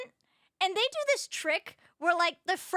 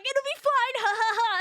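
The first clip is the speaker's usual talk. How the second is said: higher, chuckling reassurance with a mock laugh; imitating dad laughing; 5 semitones higher than usual. Dad laughing at his scared kid on the ride, mock laughing. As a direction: lightly play the dad laughing it off. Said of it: "And my dad's laughing".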